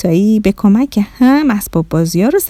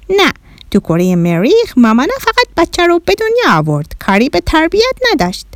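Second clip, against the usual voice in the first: put-on high-pitched alien child; little Martian boy; 8 semitones higher than usual. Bahram explains Martian mothers, little Martian voice. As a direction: answer high and matter-of-fact. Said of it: "بهرام کوچولو گفت".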